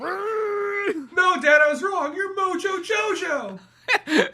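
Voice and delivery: high, crying-laughing plea; panicked pleading. Acted. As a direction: beg him to stop. Panicked son begging dad.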